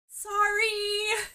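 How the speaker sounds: very high, squeaky apology; flustered squeak way up high; bird-range squeak. A narrator's reading. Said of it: "voice is in the bird range again".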